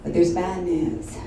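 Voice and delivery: low, soft imitation of a worried mother; mom's grave voicemail. Mother leaving daughter a voicemail, mock-grave and subdued. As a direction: lightly voice a hushed bad-news message. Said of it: "she'd left a message".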